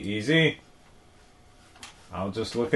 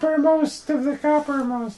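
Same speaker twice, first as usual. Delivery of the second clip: very high-pitched silly squeak; put-on squeaky character. Fumbling the band's cheer, high squeaky put-on voice. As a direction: squeak the garbled slogan in a tiny voice. Same squeaky call-and-response bit.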